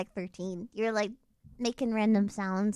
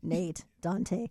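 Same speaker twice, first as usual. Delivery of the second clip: names said low; low trick voice; 5 semitones lower than usual. Retold or imitated. Sneaking up behind people, low trick voice. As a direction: drop the voice to creep up behind them. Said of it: "go behind people and say their name".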